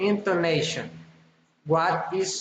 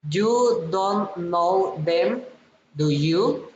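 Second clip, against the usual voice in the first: "do you" climbs at the end; rising tag question. Shown as a demonstration. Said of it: "falling or rising intonation".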